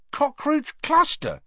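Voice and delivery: very high, slow disbelief; amazed. A narrator's reading. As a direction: question it in astonishment. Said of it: "he said, amazed".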